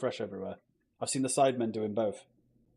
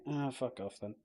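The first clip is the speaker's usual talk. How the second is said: usual speaking voice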